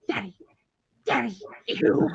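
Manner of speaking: lightly imitated toddler drama; a hint of a dramatic little girl; dramatic three-year-old. Mom mimics daughter pestering dad, childlike drama. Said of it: "Just so dramatic in her three and a half year old fashion".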